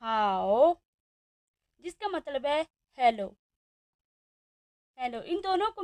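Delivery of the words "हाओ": हाओ low and dipping, said as a Chinese word; Chinese tones. Shown as a demonstration. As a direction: model हाओ slowly with a low dip. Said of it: "मिलाकर एक word बनेगा". (चीनी tone में हाओ)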